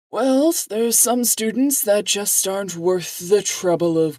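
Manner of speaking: put-on high, cold, annoyed old man; angry old man voice. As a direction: look down on her with contempt. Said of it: "pissed now, as he says".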